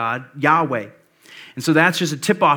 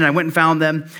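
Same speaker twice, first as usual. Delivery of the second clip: ordinary talk, nothing marked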